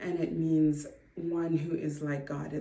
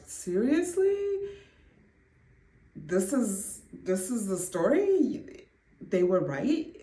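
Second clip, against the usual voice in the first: retold disbelieving questions, pitched higher; incredulous; 4 semitones higher, slower than usual. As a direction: question God in mild disbelief.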